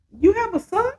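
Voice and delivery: a light curious lift, not pushed; high curious question. Retold or imitated. Alicia asking Tarika about the boy, high and curious. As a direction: ask it high and curious, voice lifted.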